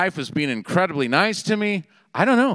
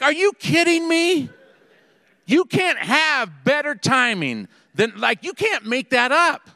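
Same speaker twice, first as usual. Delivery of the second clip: incredulous; 8 semitones higher than usual. Retold or imitated. Disbelief after car sideswipe.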